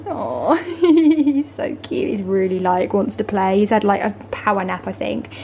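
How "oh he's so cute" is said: slow gushing laugh over a puppy; laughing coo. Real, not acted. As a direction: melt with a laugh, drawn out.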